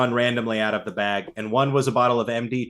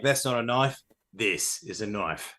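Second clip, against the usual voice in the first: go-to Aussie movie-line impression; Australian accent; 3 semitones lower, 6 dB quieter than usual. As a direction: sink into a softer, deeper Australian voice. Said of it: "I always just go to".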